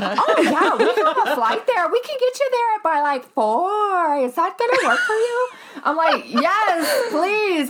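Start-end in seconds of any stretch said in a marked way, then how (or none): 0.0-5.5 high, chirpy Midwest gate agent; gate agent impression. Traveler imitating every gate agent ever, high and cheery. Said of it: "this is my impression of every, like, gate agent ever". 6.3-7.7 high, whiny begging; pleading. Retold or imitated. Traveler begging the gate agent, high and whiny.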